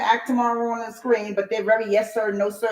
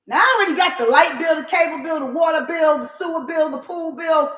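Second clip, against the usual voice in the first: exasperated rant; 7 semitones higher than usual. Real, not acted. Ranting about pile of bills.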